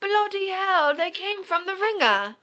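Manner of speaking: high, loud mock British exclamation; over-the-top British outburst; British accent. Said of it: "talking in a British accent".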